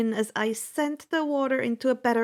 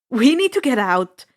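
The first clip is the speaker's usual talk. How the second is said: raised, urgent call to get moving; 9 dB louder than usual. Acted. Percy urging friends to flee the net, loud and urgent. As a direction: push the words out loud and urgent. Escaping a closing trap.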